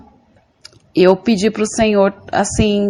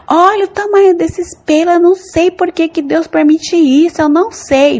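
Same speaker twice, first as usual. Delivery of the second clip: angry rant; 9 semitones higher than usual. Retold or imitated. In a dream, complaining to God about her leg hair, angry high shout. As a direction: rage at the hair like it offends you. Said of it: "Ficava tirando assim com raiva".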